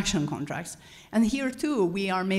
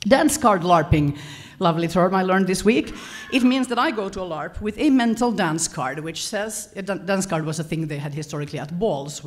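ordinary talk

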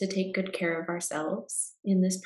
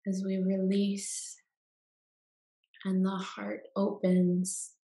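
guided meditation. Acted. Guide leading listeners into Yoga Nidra rest, calm meditation voice.

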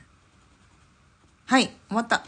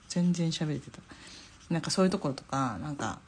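ordinary talk, nothing marked

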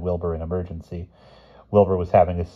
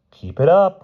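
high, loud warning; bossy schoolboy warning; bossy order; 12 semitones higher, 9 dB louder than usual. A narrator's reading. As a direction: order him sharply, voice raised. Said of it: "ordered Bruno".

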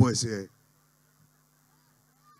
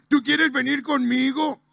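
higher, eager cartoon husband; Homero; 6 semitones higher than usual. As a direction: ask it in a lifted cartoon voice. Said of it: "Homero llega y dice". (marido de dibujo animado preguntando, tono agudo)

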